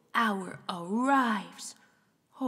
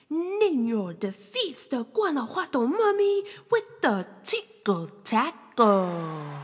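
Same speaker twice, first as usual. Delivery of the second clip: ordinary delivery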